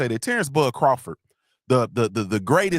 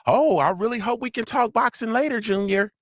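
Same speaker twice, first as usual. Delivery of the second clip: higher-pitched mock-innocent imitation; imitating Lala D; 7 semitones higher than usual. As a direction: tease her with a raised, put-on sweet voice. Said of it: "She be, 'Oh".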